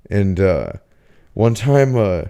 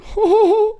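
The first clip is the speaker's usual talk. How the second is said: high squealing laugh. Retold or imitated. Continues roommate impression.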